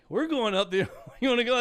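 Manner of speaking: loud, high retold call from the van; calling out over the engine; quoted shout. Driver calling to hitchhiker from the van, loud and high. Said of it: "And they said".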